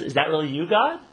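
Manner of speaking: loud, rising to a very high God at the end; can't believe it's really him; awed disbelief. Retold or imitated. Man hanging, talking to God.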